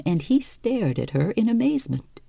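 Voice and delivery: usual speaking voice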